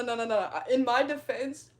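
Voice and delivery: usual speaking voice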